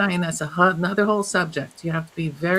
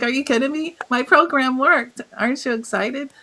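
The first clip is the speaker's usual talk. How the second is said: higher, louder, bursting with delighted triumph; gleeful disbelief; 8 semitones higher, 3 dB louder than usual. Retold or imitated. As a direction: gloat through laughter at the good news. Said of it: "And I burst out laughing".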